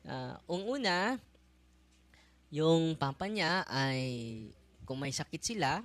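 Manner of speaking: ordinary talk, nothing marked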